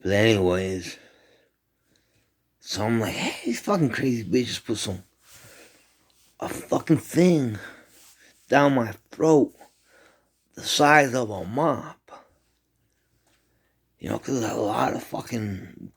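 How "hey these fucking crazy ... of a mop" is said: outraged complaint. Retold or imitated. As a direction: rant in disbelief. Complaining about crazy girls.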